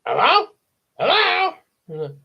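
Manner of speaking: very high parrot squawk; parrot calling. Acted. Bird calls down the chimney to Matilda, high squawk. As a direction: squawk out for attention. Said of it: "The bird called down to her".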